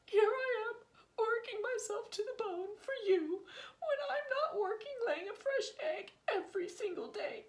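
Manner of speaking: indignant wife crow. Acted. Wife crow rants at husband.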